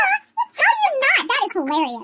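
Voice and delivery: ordinary delivery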